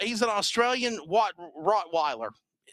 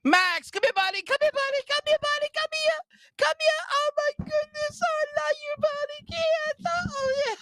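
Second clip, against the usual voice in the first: squeaky high dog-talk voice; pet talk. Real, not acted. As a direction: coo at the dog like a puppy. Calling and cooing to his dog.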